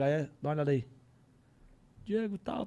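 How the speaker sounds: normal talking voice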